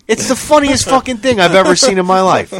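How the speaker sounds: ordinary delivery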